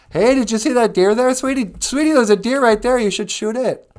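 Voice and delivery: very high, loud calling voice; shrieky, oblivious chatterbox wife; wife blurting out. Acted. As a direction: call out eagerly and scare off the game. Oblivious wife calling out, scaring deer.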